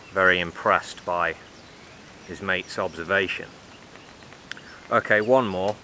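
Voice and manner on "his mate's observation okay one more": usual speaking voice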